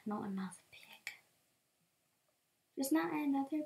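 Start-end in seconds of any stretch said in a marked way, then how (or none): 0.1-1.1 unvoiced groan of dismay; whisper. Real, not acted. Spotting yet another pig, whispering in dismay. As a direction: whisper it in despair.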